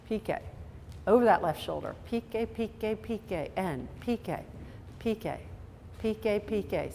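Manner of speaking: short, clipped, quiet "piqué" calls; staccato. Shown as a demonstration. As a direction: snap each "piqué" out sharply and briefly. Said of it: "do that very staccato like you do".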